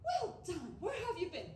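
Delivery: impressed neighbor. Retold or imitated.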